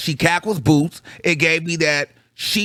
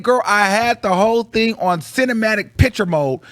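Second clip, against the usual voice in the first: ordinary talk, nothing marked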